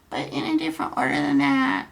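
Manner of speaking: high, quick put-on girl voice; girl's chat voice. Streamer joking in the teen girl's voice, high falsetto. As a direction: toss it off in falsetto. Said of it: "forgive my voices".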